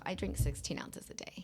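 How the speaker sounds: soft, whispered confession; hushed admission. Real, not acted. Guest admitting to host how little water she drinks, hushed. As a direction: drop to a near whisper.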